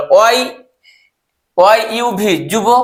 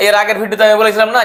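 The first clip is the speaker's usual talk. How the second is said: ordinary talk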